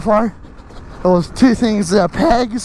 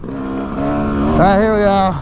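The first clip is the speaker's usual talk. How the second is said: usual speaking voice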